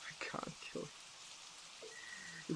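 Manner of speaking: near-whispered, soft admission; hushed confession. Acted. Fighter confessing to a loved enemy they can't kill, hushed. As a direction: barely voice it, letting the words fall away.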